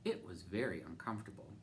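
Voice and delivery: ordinary delivery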